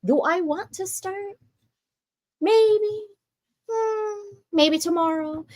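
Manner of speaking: high, dithering voice of someone putting it off; procrastinating inner voice. Retold or imitated. Acting out her procrastinating inner self, high and dithering.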